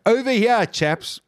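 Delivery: mock-casual hail to a boat; jokey polite call. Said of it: "jokingly, I thought I said, like".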